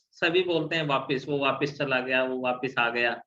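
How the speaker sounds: ordinary delivery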